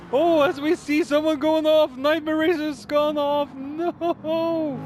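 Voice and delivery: high, loud race-call burst; excited shout. Real, not acted. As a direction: yell it as the car flies off. Racer crashes off the track.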